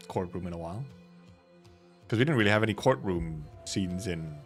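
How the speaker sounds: usual speaking voice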